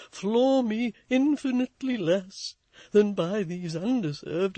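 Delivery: solemn, slow, overwrought plea; awful voice. A narrator's reading. Toots solemnly protesting Florence's kindness. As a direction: make it grave and nervous. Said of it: "in an awful voice".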